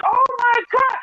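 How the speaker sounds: high, loud shriek of surprise; imitating wife's excited scream; mock scream. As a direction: squeal it out like a starstruck fan. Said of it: "my wife screams like".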